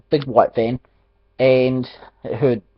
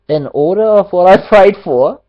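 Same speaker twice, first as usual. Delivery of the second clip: laughing; 6 semitones higher, 7 dB louder than usual. Real, not acted. Laughs recounting answered prayer.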